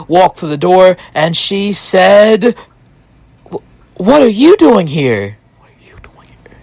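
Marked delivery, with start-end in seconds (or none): 3.5-5.4 high, startled question; surprised. Retold or imitated. Wife startled to find her husband there, high surprise. Wife shocked to see him. 5.6-6.6 fast breathless whisper. Retold or imitated. Wife repeats her shock in a hushed whisper.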